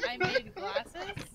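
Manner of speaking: slow, high puzzled question; confused. Real, not acted. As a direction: ask it slowly, baffled. Puzzled mishearing.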